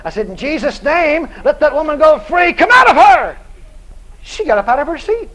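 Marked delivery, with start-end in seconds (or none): 0.3-3.4 slow start, then a rising, much louder shout; commanding shout. Retold or imitated. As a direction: cast the demon out with full authority. Preacher commands the demon out.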